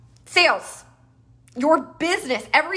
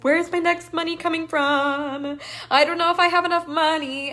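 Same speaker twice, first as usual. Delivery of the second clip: higher, anxious worrying voice; mock worried self; 4 semitones higher than usual. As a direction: voice your old money panic. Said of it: "I was always like".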